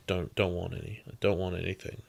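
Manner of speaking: put-on girl quietly refusing food, sulky and soft; sulky refusal. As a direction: grumble it with arms crossed. She's got her arms crossed.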